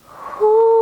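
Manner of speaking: high, sustained hoo; owl hoot. Pretend. Reader turning head as an owl, long high hoot. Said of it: "one more time".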